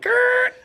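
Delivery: very high-pitched mock squeal; high yelped noise for laughs; comic squeak. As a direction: let out a sudden high cartoonish squeak. Comic reaction noise in retold story.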